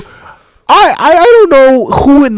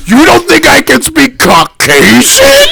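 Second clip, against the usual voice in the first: slow, raised mock indignation; a lightly hammed offended coworker; mock outrage, higher and slower; 8 semitones higher, slower than usual.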